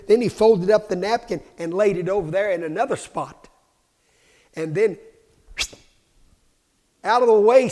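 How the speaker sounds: loud, fast-building shouted preaching; fiery revival preaching; preaching at full force. Acted.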